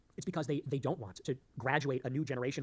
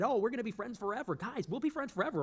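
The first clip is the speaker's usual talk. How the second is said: higher-pitched imitation of gushing pals; mock chummy promise; 8 semitones higher than usual. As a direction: mimic an over-friendly pledge in a raised voice. Mimics others promising lasting friendship.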